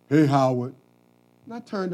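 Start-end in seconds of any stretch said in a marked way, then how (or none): 0.1-0.7 second call, louder and more insistent. Retold or imitated. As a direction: call again so he finally turns around. Stranger calling again, louder.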